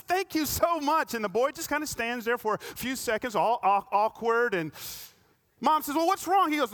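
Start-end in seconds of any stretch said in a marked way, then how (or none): 0.0-1.1 mock tearful thank-you, higher and slower; a hint of a mother choked up with gratitude; touched mother. Mother moved to tears. 5.9-6.5 higher, louder mock puzzled mom; a light sketch of a puzzled mother. Mother asking the boy.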